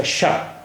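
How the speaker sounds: शाह with a soft hushing sh, breathy and near-whispered; sh sound. Shown as a demonstration. Teacher showing students the dotted letter, soft hushed शाह. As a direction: hush the शाह like a clean sh. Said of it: "तो ये शाह का उच्चारण हो जाता है".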